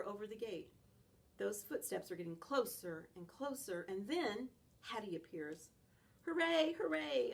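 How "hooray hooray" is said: high, loud happy cheer; excited barking. Acted. Dog greeting Hattie at the gate, high and excited. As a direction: cheer like a puppy who sees its owner. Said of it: "I bark".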